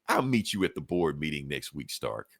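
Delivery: low, soft, sinking villain sign-off; cool parting threat. Retold or imitated. Victorious villain leaving Stark beaten, coolly menacing. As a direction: drop it low and quiet, fully in control.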